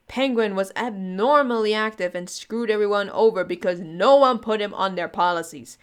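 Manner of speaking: usual speaking voice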